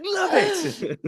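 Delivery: high, thrilled exclamation of love; delighted squeal. Retold or imitated. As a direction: gush it out with glee. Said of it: "It's like".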